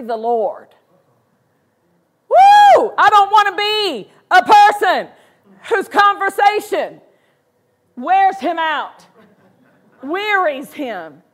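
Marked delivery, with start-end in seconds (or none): 2.3-2.9 sudden high loud whoop. Real, not acted. Preacher whooping to the church, loud and high. 3.0-11.2 higher, louder passionate declaration; fervent preaching. Real, not acted. Preacher declaring to the church, higher and louder. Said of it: "fervent preaching".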